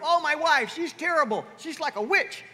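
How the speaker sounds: loud, high mock complaint; hammed-up exasperation; griping husband. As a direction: act out a husband whining about his wife. Mimics husband griping in guys' group.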